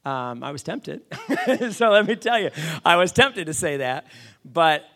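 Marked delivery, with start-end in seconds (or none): 1.1-4.1 high, loud, through laughter; laughing admission. Real, not acted. Speaker admits he was tempted to defy mentor, laughing. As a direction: laugh while confessing.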